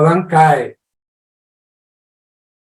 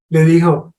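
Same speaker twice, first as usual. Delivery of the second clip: ordinary delivery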